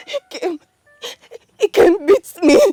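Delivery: tearful, halting report through sobs; crying. Acted. Woman tells her friend who beat her, crying. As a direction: sob between the phrases. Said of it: "Why are you crying?".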